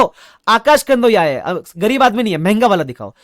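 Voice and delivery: mock-grand boast at the shop counter; showy boast. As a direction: stretch it out like a rich man showing off. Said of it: "मैंने बोला".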